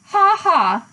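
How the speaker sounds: a loud, high, hammed-up "ha ha" laugh; hearty laugh. A narrator's reading. Uncle the pig laughing loudly at his own weak joke.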